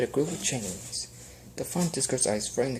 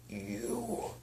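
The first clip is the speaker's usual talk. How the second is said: whispered; 11 semitones higher, 9 dB quieter than usual. Acted. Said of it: "he whispered".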